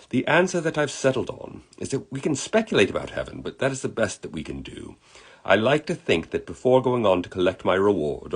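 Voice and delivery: reflective put-on British accent; storybook British gentleman reminiscing; terrible British accent. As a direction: muse aloud as an amateur-accented Englishman. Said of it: "I do a terrible British accent".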